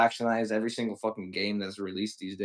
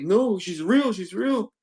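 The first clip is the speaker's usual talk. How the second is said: high, pleading mock protest; imitating upset friend; 10 semitones higher, 3 dB louder, slower than usual. Retelling a heartbroken friend denying his chatbot girlfriend, high pleading voice. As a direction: mimic a crushed friend insisting. Retold friend insisting, he was crying.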